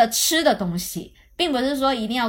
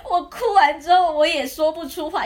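usual speaking voice

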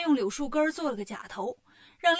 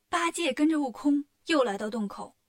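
usual speaking voice

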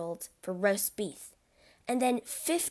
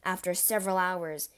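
ordinary delivery, nothing marked

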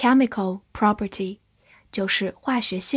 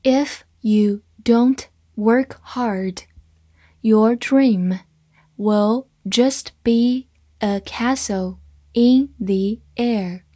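slow, word by word, with pauses; slow reading; 3 dB louder, slower than usual. Shown as a demonstration. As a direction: read it out slowly and distinctly for learners. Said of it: "慢慢来读". (慢慢地逐词朗读)

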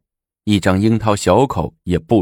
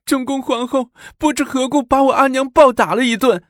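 put-on very high, tearful girl's voice; sobbing child. A narrator's reading. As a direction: tell it haltingly through sobs. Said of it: "只是嘤嘤地哭着". (小女孩哭着断断续续地诉说)

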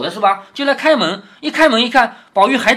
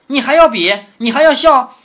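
sharp, louder rising questions; indignant retort; 5 semitones higher, 6 dB louder than usual. Acted. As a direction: throw the accusation back at him. Daiyu's angry retort, voiced.

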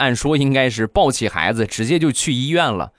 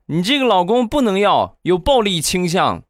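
put-on high little boy voice, indignant and raised; a kid scolding his uncle in a raised voice; cheeky child; 9 semitones higher than usual. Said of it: "说完他就说". (孩子气呼呼的高声数落)

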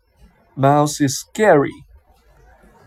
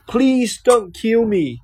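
begging; 7 semitones higher than usual. A narrator's reading. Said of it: "begged the mouse".

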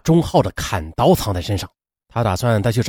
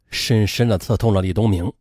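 plain reading voice

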